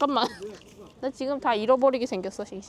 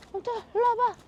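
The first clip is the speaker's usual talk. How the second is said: calling a dog; 11 semitones higher than usual. Real, not acted.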